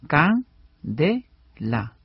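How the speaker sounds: "can, de, la" as three isolated syllables; measured syllable demonstration. Shown as a demonstration. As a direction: pause after each syllable, dropping on "la". Spells candela out by syllables.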